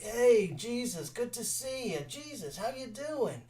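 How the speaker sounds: high, drawn-out hippie greeting; laid-back hippies. Retold or imitated. Hippie disciples welcoming Jesus, high and lazy. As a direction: greet Jesus like stoned groupies. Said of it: "everyone's like".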